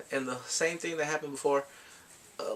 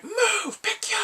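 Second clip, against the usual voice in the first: high, loud urgent call; urgent shout; 4 dB louder, much slower than usual. Retold or imitated. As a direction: yell to get moving. Rescuers rush back in.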